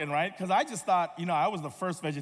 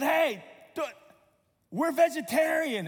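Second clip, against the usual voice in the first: high, raised-voice protest; yelled out; 10 semitones higher, 5 dB louder than usual. Retold or imitated. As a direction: call out in alarm across the table. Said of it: "I yelled out".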